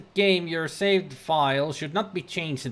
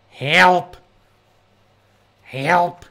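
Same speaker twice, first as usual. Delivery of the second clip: mock cry for help; 8 dB louder than usual. Real, not acted. Streamer panics, calls for help.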